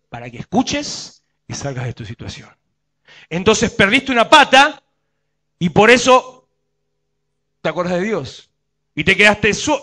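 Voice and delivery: ordinary talk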